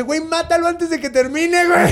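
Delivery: high, loud put-on voice pressing hard; urgent Enkidu. Enkidu urging Gilgamesh to kill Humbaba, high urgent put-on voice. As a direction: hurry him like a panicked buddy. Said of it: "Enkidu y le dice".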